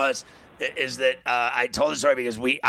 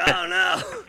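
mock panic; 12 semitones higher than usual. Panic as colleague starts crying.

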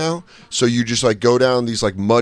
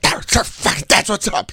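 hyped; 9 semitones higher than usual. Retold or imitated. Imitating band after show.

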